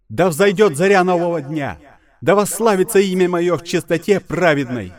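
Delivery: loud, high divine wrath; booming goddess voice. Acted. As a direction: thunder it down as an angry goddess. (голос разгневанной богини)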